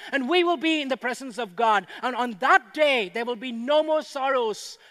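rising revival preacher crescendo; preaching at full force. Real, not acted. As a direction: build the crowd to a triumphant peak. Climax on resurrection hope.

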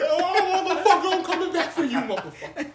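high-pitched, hammed-up threat; squealed comic vow of revenge; mock vengeful yell. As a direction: cry revenge while being dragged off. Acts out being dragged away threatening.

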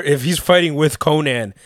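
usual speaking voice

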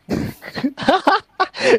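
shrill burst of laughter; high squealing laugh. Real, not acted. Laughing at the camp slang. (tawa melengking tinggi)

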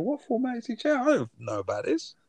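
high-pitched mock bafflement; baffled listener. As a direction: act out a listener's puzzled thought, pitched high. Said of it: "and I'm thinking".

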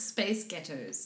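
American accent demo. Shown as a demonstration. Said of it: "In an American accent".

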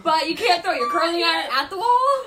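laughing in disbelief. Real, not acted. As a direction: laugh through the words. Incredulous laughing exchange.

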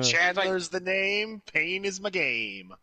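slow mock-cool self-introduction; cheesy character catchphrase. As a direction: draw out the catchphrase with swagger. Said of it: "I go".

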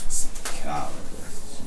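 drawn-out word as if writing it down; slow, half-voiced "Psychologist" while writing; whispered and stretched "Psychologist" spelled out onto the page; slow note-taking mutter. Shown as a demonstration. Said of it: "Let me amend my notes".

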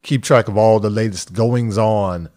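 usual speaking voice

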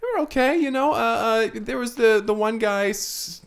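halting high voice, dragging out the name; hesitant acted witness. Janitor answers about the opera's reviews, hesitant acted voice.

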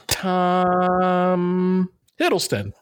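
TOM stretched out very long and high before "Hiddleston"; mock hesitant guess. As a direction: hold "Tom" as if searching, then drop "Hiddleston". Retold hesitant answer, wrong Tom.

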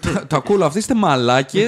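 playful shouted insult; mock angry outburst. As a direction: blow up at your friends in mock anger. Joking outburst at his co-hosts.

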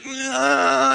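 strained grunt. Retold or imitated. Son straining lifting drywall.

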